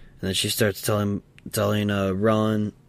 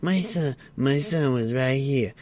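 mother's indignant insistence; 5 semitones higher, slower than usual. Retold or imitated. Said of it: "she's like".

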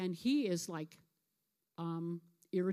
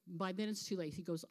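ordinary delivery

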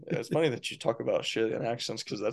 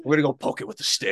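Steve Irwin; 4 semitones higher than usual. Retold or imitated. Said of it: "Steve Irwin".

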